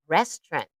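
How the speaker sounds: Restaurant said as two syllables, res-trant, middle syllable dropped; native speaker pronunciation. Shown as a demonstration. Teacher modelling Restaurant for the class, middle syllable dropped. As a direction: say Restaurant as ress-trunt, swallow the middle. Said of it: "You almost don't hear this middle syllable".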